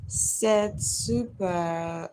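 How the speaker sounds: "Louis said super" read slowly, each word sounded out carefully; slow, careful stab at foreign words. Shown as a demonstration. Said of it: "Hope I got it right".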